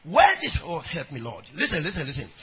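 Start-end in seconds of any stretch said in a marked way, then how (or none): none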